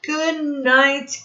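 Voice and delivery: normal talking voice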